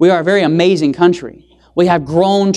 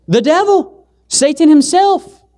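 dramatic reveal; 8 semitones higher, slower than usual. Acted.

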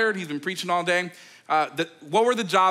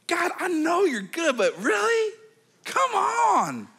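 high, pleading disbelief; mock frustrated prayer; exasperated plea; 13 semitones higher, slower than usual. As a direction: cry out to God in frustration. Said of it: "I'm over there like".

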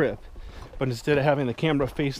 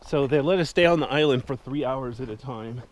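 ordinary talk, nothing marked

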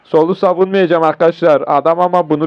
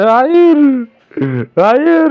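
cracking cry of no; 9 semitones higher than usual. Real, not acted. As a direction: yelp it as the play fails. Losing the game, cries no.

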